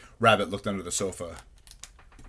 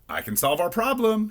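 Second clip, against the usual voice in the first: raised, high-pitched call; shouting; 14 semitones higher, 3 dB louder than usual. A narrator's reading. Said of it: "he shouted".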